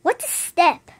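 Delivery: ordinary delivery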